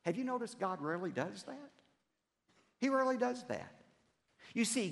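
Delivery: normal talking voice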